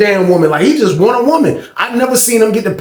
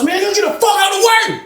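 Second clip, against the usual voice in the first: high-pitched mock yell; angry outburst; 13 semitones higher than usual. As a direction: act out ordering someone off, voice shooting way up. Angry man ordering someone off.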